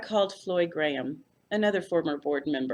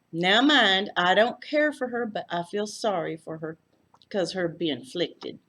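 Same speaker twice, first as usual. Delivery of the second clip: Texas lady; 2 semitones higher, 5 dB louder than usual. A narrator's reading. Floy's voice.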